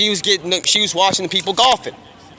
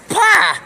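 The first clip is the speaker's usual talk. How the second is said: sudden high, loud "pah!"; sharp high-pitched interjection; explosive "pah"; 12 semitones higher, 5 dB louder than usual. Acted. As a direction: burst out with a mock exclamation. Acted exclamation inside a quote.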